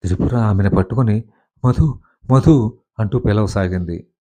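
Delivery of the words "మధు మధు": anxious calling. A narrator's reading. Calling fainted friend.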